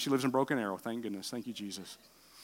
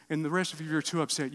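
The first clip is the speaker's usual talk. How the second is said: normal talking voice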